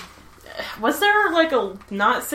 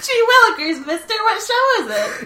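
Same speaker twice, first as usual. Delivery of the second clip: put-on high, wide-eyed gee-whiz kid; wholesome 1950s kid; 11 semitones higher than usual. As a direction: ask it like an eager sixties kid. Acting sixties kid.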